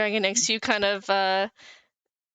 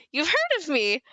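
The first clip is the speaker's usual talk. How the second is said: higher-pitched, lightly voiced elf; pleased elf; 7 semitones higher than usual. Purple elf turning to the adventurer, high pleased voice. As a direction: pitch it up, pleased at being recognised.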